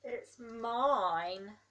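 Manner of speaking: loud, proud lion claim; scary lion. Acted. Lion claiming its big mouth, loud and proud. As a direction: boom it out to make the child jump.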